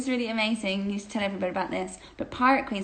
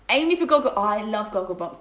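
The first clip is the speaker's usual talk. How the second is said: usual speaking voice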